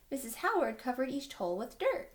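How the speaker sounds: plain reading voice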